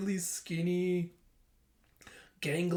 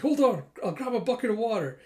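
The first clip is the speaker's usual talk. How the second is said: raised, higher, louder voice; acted urgency; 7 semitones higher, 6 dB louder than usual. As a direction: call out in a hurry to help. Urgent response to the fire.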